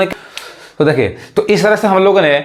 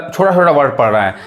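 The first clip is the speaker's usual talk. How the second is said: normal talking voice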